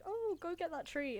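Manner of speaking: lightly voiced grandma. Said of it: "grandma's still like".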